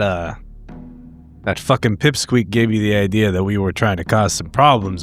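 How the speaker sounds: normal talking voice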